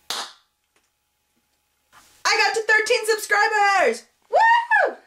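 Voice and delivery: high, drawn-out celebratory cheer; super excited. Real, not acted. Vlogger announcing a subscriber milestone, super excited. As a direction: belt out the big news, ending in a high whoop. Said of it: "I'm super excited about it".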